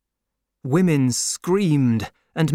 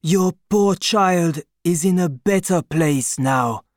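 gentle comfort; 5 semitones higher, 4 dB louder than usual. A narrator's reading. Comforting friend voiced.

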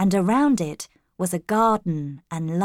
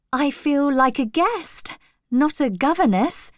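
higher-pitched, pleased private thought; happy; 8 semitones higher than usual. A narrator's reading. New governess thinking about her welcome, happily. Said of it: "I thought happily".

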